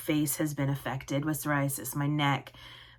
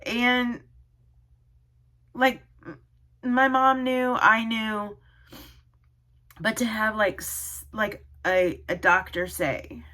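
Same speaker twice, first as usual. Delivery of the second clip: high, halting, tearful voice; choked up, stopping and starting; real crying; 4 semitones higher than usual. Real, not acted. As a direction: struggle to speak through tears. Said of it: "I don't know why I'm, like, crying so much".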